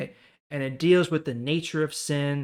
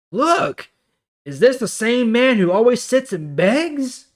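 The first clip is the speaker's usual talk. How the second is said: much higher, louder amazed exclamation; astonished neighbors; 10 semitones higher, 10 dB louder, slower than usual. Acted. Neighbors spotting the healed beggar, voiced with astonishment. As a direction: gasp it out in disbelief.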